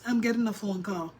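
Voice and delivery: normal talking voice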